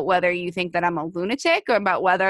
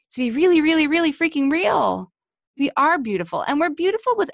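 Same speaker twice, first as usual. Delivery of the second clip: rousing; 8 semitones higher than usual. Real, not acted. Rallying sisters.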